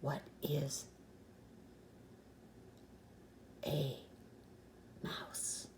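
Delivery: hushed, breathy whisper; fearful whisper. Acted. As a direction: whisper so the owl won't hear. Whispering in fear.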